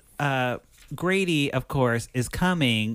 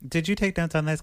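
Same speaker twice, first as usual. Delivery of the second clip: ordinary talk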